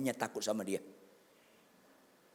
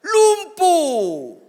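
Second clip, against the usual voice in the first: long, high bellow; shouted punchline; 17 dB louder than usual. Real, not acted. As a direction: bellow the word, drawn out.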